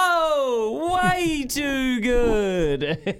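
high, long-stretched race-call cry; imitated excited race caller, soaring and drawn out; T Lee. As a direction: arc the voice right up, holding each word. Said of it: "starts to arc his voice up".

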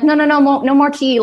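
high, loud, frantic refusal; panicked refusal. Retold or imitated. Narrator panicking, refusing more tea.

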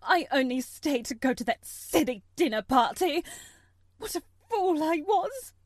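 loud, high bitter self-blame; distraught cry. Acted. Mother crying out to the officer, loud and anguished. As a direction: cry out at her own foolishness. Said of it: "she cried".

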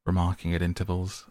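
ordinary delivery

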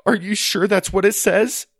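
high, loud, upset plea; near tears. Acted. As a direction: ask desperately, close to crying. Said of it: "Tears welled up in Susie's eyes".

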